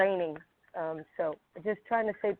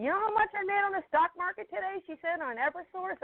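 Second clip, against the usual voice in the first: much higher mock old mother voice; imitating mom; 11 semitones higher than usual. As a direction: mimic her boasting, pitched way up. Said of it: "she keeps yelling at me".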